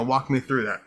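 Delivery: usual speaking voice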